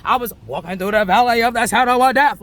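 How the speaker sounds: loud, sermon-like declaration; declaimed like scripture; psalm quote. Retold or imitated. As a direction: proclaim it as your own truth. Repeats psalm about herself.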